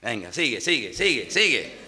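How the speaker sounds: a lightly voiced devil, quietly egging on; soft, sly goading, barely put on. Devil urging the tempted believer on, a hint of soft goading. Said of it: "Y el diablo dice".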